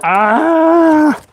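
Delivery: high sharp cry of pain; real yelp as it burns. Real, not acted. Burned again while drilling.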